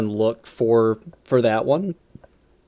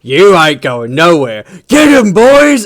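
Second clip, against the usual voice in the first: commanding war boss; 8 dB louder than usual. Retold or imitated. War boss ordering his boys to grab the orc, loud. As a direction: stop him, then sic the boys on him. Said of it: "the war boss is like".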